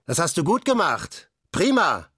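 proud shout of approval; calling praise. A narrator's reading. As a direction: cheer him on. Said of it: "Und Olaf rief noch".

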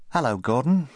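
high, friendly hello; boy greeting the parrot by name; corrected greeting. Acted. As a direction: try again with the right name. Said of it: "said Rupert".

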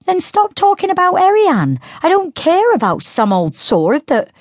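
high, pushed protest; upset girl. A narrator's reading. Sister snapping at Diello to stop, high and upset. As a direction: cut him off, annoyed. Synth angry.

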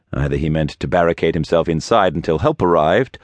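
ordinary delivery

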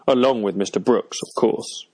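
offhand addition, falling away; mildly dismissive, trailing off on "of course"; tacked-on afterthought. A narrator's reading. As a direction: toss it in as an afterthought. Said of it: "as if it were an afterthought".

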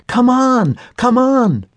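high, loud urgent call; screaming. A narrator's reading. Girl racing uphill, shouting at her family to hurry. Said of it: "she screamed".

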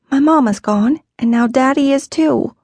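a hint of a sad young girl; girl's sad admission. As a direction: say it like a child near tears. Kara's sad answer.

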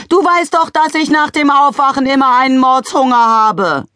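high, loud nagging wife; demanding wife. A narrator's reading. Wife nagging husband about her hunger, loud. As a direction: nag at the top of her voice.

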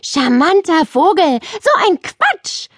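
very high shrieking girl's voice, mock hysterical; a girl shrieks at the silly parrot; hysterical screech. A narrator's reading. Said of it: "kreischte sie hysterisch".

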